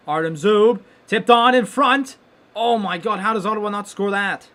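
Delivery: excited yell. Real, not acted. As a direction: voice jumps high and loud at the chance. Big chance in front.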